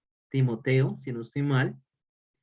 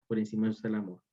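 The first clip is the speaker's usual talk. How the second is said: the same line again, much softer and lower; soft echo; 4 semitones lower, 6 dB quieter, much faster than usual. Shown as a demonstration. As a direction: let it settle quietly. Repeated for emphasis. (repetición suave y baja)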